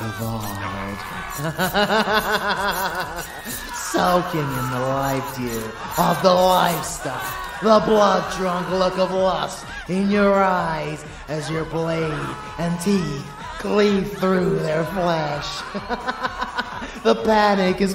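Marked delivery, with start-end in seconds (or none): 1.0-3.7 long, high villainous laugh; evil cackle. Acted. Vampire revels in the slaughter. 15.7-17.0 short, high villainous laugh; gleeful cackle. Acted. Vampire revels in the slaughter.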